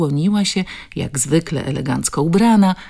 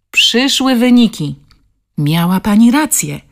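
louder and higher, bursting with good news; animated; 6 semitones higher, 7 dB louder than usual. A narrator's reading. The director telling Basia the results, excited. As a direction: deliver the news with lively excitement. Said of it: "powiedziała ożywiona".

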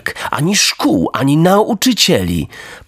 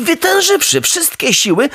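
plain reading voice

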